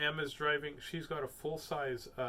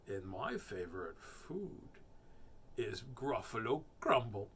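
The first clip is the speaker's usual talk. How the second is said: sly mouse threat; 3 semitones lower than usual. Acted. Mouse threatening the Gruffalo, slow sly voice. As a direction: savor the threat.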